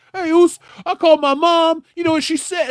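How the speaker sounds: high, loud goofy mimic of a wrestler's promo; mocking promo voice. Friend mocks a bad wrestling promo, high loud goofy impression. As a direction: squeak it out like a silly tough guy. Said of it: "the one where he's like".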